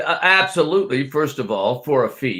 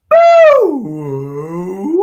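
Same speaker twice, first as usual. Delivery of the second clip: long drawn-out loud boo; loud boo warm-up; 4 semitones higher, 7 dB louder than usual. Shown as a demonstration. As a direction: hold a big booming boo. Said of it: "That's a good one".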